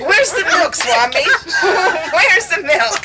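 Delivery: high, laughing mock demand; uppity demand. Devotee retelling how she demanded the milk, in a high laughing mock-uppity voice. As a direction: pester the guru for the missing milk, laughing. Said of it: "We're all uppity about it".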